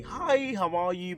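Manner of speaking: high-pitched imitation of a chatty teacher; gushing greeting. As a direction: greet with an exaggerated singsong lift. Teacher greets her in retelling.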